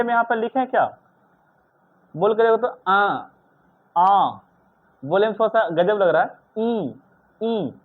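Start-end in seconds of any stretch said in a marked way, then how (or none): 2.1-4.4 आ said through mouth and nose together; teacher demonstrating a nasalised आ; nasal vowel demo. Shown as a demonstration. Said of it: "नाक लगाए पड़ रहा है". 6.6-7.7 ई said softly through mouth and nose; teacher voicing ई through the nose; nasal vowel demo. Shown as a demonstration. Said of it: "नाक लगाए पड़ रहा है".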